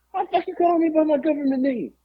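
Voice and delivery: high caricature grumble; mock New Jack. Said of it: "He's like".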